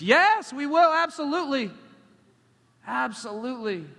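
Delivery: high, loud, eager shout easing off; emphatic agreement. Retold or imitated. Porch men promising to pray for the street team, loud eager yes. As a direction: agree wholeheartedly at full volume.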